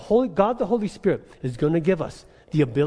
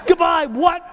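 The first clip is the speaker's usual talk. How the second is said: high, laughing "Goodbye, what?"; incredulous laugh; laughing disbelief; 11 semitones higher, 4 dB louder than usual. Real, not acted.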